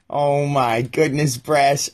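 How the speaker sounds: ordinary talk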